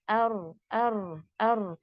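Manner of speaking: अर drawn out slowly with a slight trill; slow light trill. Shown as a demonstration. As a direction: hold अर and let it flutter. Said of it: "थोड़ी सी vibration".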